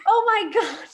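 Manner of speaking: high, loud, laughing oh my god; excited gasp. Retold or imitated. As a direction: squeal it out, thrilled. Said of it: "she was like".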